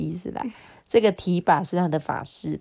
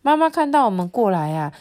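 ordinary delivery, nothing marked

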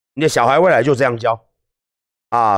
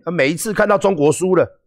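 ordinary talk, nothing marked

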